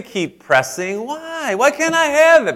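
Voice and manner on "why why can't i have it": put-on very high, pleading kid; whiny child voice. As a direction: whine and press like a child. Child keeps pressing parent.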